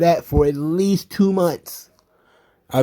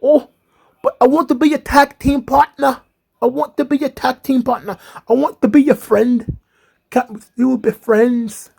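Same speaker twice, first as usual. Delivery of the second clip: high clingy pleading Nikki voice; imitating Nikki; 7 semitones higher than usual. Wrestling podcaster mocking clingy Nikki, high and pleading. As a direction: whine it up high like a needy friend. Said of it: "Nikki is like".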